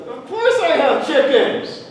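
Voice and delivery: drawn-out comic exasperation; exasperated answer. Acted. Farmer answers the chicken question, slow exasperation. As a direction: stretch it out, at the end of your tether.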